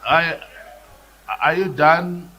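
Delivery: loud, curt cut-off. Real, not acted. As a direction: cut them off loudly. Speaker cuts off shouting member.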